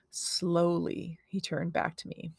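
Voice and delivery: ordinary delivery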